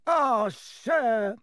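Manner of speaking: high, tearful gasp; gasping elf. A narrator's reading. Tearful elf overcome by Harry's story, gasping. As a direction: catch the breath on it. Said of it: "he gasped".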